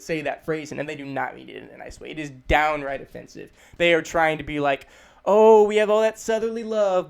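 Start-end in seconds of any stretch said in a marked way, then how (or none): 5.2-7.1 loud, high gush of false love; hammed-up sweetness hiding meanness; fake Southern sweetness. Southerners pretending to be loving, passive-aggressive sweetness. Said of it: "what they're really doing is they're being mean".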